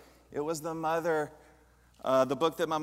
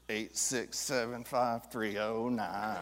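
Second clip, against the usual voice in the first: slow, quiet, spelling out each digit; slow dialing recital; 4 semitones lower, 5 dB quieter, slower than usual. Retold or imitated. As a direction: read the number out slowly as if dialing. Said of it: "You would go".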